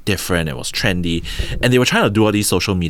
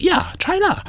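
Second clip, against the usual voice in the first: imitating the CMO in a much higher, breezy voice; casual CMO offer. CMO offering the deal to the founders, high breezy imitation. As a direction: toss off the offer in a high, light voice. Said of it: "And she was like".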